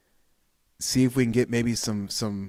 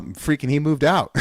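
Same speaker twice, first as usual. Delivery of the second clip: ordinary talk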